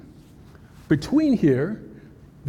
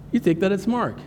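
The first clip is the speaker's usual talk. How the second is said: ordinary talk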